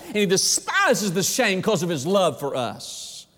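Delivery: high-pitched, full-force pulpit climax; real sermon crescendo, phrase upon phrase; impassioned preaching. Real, not acted. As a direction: preach it with mounting fervor, drawing out key words.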